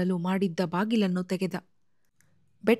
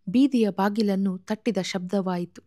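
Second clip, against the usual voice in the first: plain reading voice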